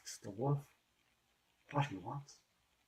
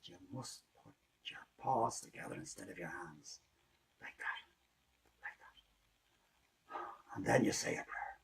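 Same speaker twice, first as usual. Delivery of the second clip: soft hushed whisper; whispered instruction; 8 dB quieter than usual. Acted. Rooster instructs in hush.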